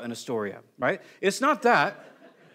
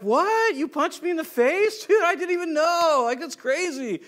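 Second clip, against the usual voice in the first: much higher, louder, laughing disbelief; mock astonished; 10 semitones higher, 5 dB louder than usual. Preacher mocking a believer who forgot being punched, giddy high disbelief. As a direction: ham up a clueless, overjoyed new believer.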